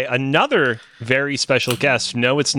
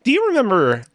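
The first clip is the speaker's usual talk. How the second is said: normal talking voice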